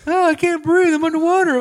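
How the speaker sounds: high, loud hammed-up distress; mock drowning panic. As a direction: cry out as if going under, playing it for laughs. Acts out drowning victim in panic.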